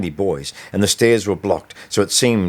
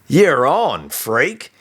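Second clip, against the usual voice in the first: higher, louder sneering acceptance; bully accepting the fight with a malicious sneer; 7 semitones higher, 3 dB louder, much slower than usual. A narrator's reading. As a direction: sneer it like he's already won. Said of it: "he said with a malicious sneer".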